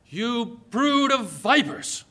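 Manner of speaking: slow, drawn-out scornful rebuke; stern rebuke. Acted. Jesus denouncing the Pharisees, slow and stern. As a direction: lean into brood as a condemnation.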